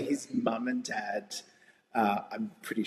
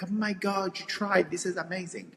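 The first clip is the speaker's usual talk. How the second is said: delighted gush, higher; mock excited Italians; 6 semitones higher than usual. Friendly Italians praising a learner, lightly mimicked delight. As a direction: praise her attempt like a thrilled local. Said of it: "And they're like".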